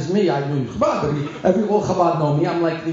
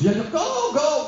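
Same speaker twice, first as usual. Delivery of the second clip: eager; 9 semitones higher, slower than usual. Retold or imitated. Rabbi eagerly agreeing.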